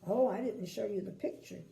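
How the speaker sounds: usual speaking voice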